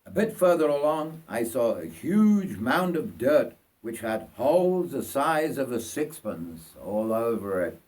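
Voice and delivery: ordinary delivery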